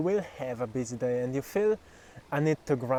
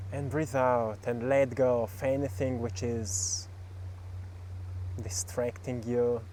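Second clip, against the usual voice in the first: guided meditation; 3 dB quieter than usual. Acted. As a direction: guide them gently through each breath.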